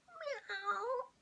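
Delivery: high meowing cat. Acted. Ginger cat answering Missus Jolly, high mew. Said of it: "I needed my cat voice there".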